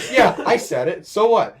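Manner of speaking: loud, defensive mocking retort. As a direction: throw it back defensively. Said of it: "You got so defensive".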